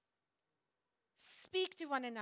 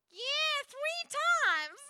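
high, slow, laughing South Carolina drawl; mock South Carolina accent, much higher; Southern US accent; 9 dB louder, much slower than usual. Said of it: "that was bad accent".

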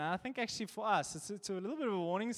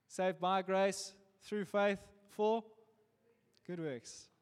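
slow, weighted words on "Saved by grace"; deliberate sermon cadence; slow preaching emphasis; much slower than usual. Acted. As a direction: lay out each phrase like a creed. Preaching key verse slowly.

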